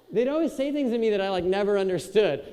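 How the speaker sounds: ordinary delivery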